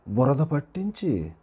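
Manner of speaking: plain reading voice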